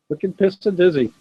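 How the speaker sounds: chuckling through the Boston slang; amused, laughing repeat of the slang. Real, not acted. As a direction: laugh as you repeat wicked pissa.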